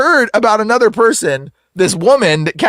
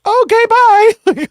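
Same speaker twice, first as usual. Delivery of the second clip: very high, drawn-out goodbye; mock cheery sign-off; 4 dB louder, much slower than usual. Man ending the call after useless advice, falsely cheery. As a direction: squeak it out slowly, hammed-up.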